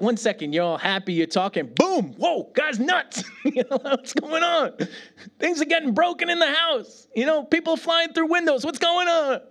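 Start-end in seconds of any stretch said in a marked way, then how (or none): 1.8-9.4 high, laughing mock panic; mock alarm. Preacher laughing about his raging dad. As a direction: act out the chaos with a laugh.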